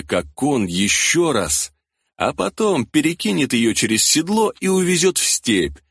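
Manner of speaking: plain reading voice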